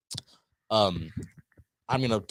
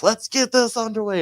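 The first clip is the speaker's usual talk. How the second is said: high, loud imitation announcer; ring announcer call; 12 semitones higher, 5 dB louder than usual. Announcer Mark opening the six-man tag match, high loud call. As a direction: launch the match, voice pitched way up.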